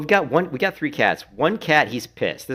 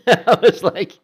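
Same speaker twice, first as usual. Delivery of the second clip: laughing; 12 semitones higher, 12 dB louder, slower than usual. Real, not acted. Laughing after the story.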